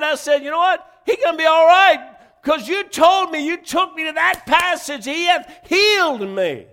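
loud, high, fervent preaching; preaching at full force. Acted. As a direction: shout out the testimony of healing. Preaching climax.

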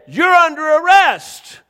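drawn-out, high, loud arrest; retold officer's shout.

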